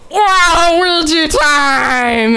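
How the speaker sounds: very slow, high, hammed-up crying wheeze; wheezed last words as Waluigi. Storyteller voicing Waluigi's dying breath in a fan fiction, slow high crying wheeze. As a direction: drag out a dying Waluigi's final cry. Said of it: "Waluigi wheezed out".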